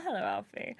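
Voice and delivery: soft, lightly put-on character voice; a hint of a game character's voice; Liam. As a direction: drop it softly, lightly in character. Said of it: "I said that in Liam's voice".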